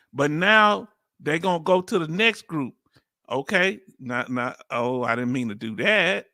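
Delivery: ordinary talk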